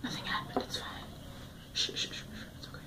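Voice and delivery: very soft, voiceless self-soothing whisper; hushed whisper. Real, not acted. Calming herself after the glove drop, whispering. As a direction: hush it right down, recovering from a scare.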